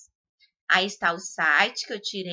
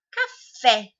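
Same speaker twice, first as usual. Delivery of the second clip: Café louder, with FÉ punched; stress on last syllable; 2 semitones higher, 6 dB louder than usual. Shown as a demonstration. Teacher giving an oxytone example, stress on FÉ in Café. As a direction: hit the final -fé of Café. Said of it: "o fé".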